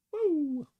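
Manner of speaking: a high, loud whoop; excited whoop. Real, not acted. Streamer whooping to himself, excited. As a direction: let out a happy whoop.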